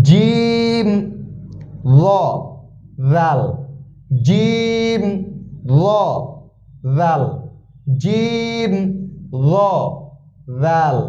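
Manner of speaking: জিম, ল and জাল each held apart, slow and careful; drilled Arabic letters. Shown as a demonstration. Teacher drills three important letters for students. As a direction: contrast জাল with a rounded mouth. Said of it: "উচ্চারণের দিকে খেয়াল করি".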